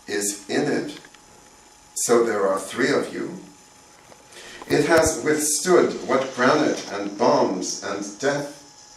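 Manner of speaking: measured recital in short, separated phrases; poetry reading. Acted. Poet reads a poem about bamboo to audience, poetry reading. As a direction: read the poem deliberately, pausing at each line break.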